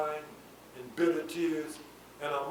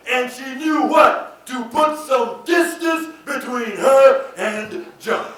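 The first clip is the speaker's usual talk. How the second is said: loud, high teaching from the pulpit; forceful preacher's call-and-response; emphatic preaching; 8 semitones higher, 12 dB louder, slower than usual. Real, not acted. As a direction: drive the point home, calling for the congregation's answer. Preaches to the congregation.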